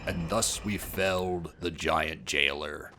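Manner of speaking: low, slow storyteller proclamation; mock-epic narrator. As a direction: intone it like a solemn chronicle, softly. Mock-epic recap of beating boss.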